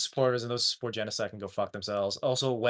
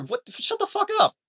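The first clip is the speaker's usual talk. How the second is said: higher-pitched exasperated snap; angry outburst; 11 semitones higher than usual. Real, not acted. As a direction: tell them off, fed up. Angry rant at Israel's defenders.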